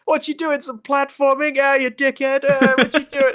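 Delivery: cartoon alien in a thick New York accent. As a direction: bark it like a New York construction guy. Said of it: "really thick New York accents".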